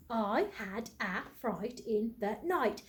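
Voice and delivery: slow, each word set apart; slow counting. Shown as a demonstration. Teacher counts the words aloud for children, slow and spaced. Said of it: "Let's count those words".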